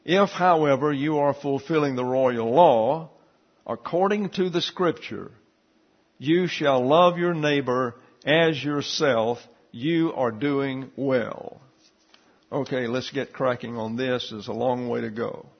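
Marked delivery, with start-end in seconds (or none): 0.0-11.6 scripture reading. Shown as a demonstration. Reads James 2:8 aloud.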